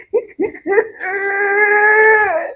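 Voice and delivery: big high burst of laughter, almost crying; howling with real laughter; laughing so hard it squeaks. Real, not acted. Cracking up at own joke.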